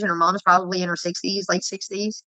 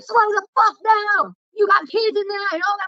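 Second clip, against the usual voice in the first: imitating dad yelling; 12 semitones higher than usual. Dad cussing out speeding bus drivers, imitated yelling. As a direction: holler like an irate dad at passing drivers. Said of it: "My damn dad yelling at the bus drivers".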